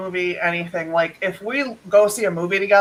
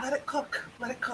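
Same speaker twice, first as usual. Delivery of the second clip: murmured aside; 5 semitones higher, 16 dB quieter than usual. Real, not acted. Quiet aside.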